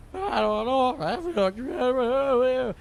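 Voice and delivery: high, very slow dazed voice; woozy drugged voice. Retold or imitated. Tranquilized suspect answering the cop, slow dazed voice. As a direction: drag it out like someone drugged.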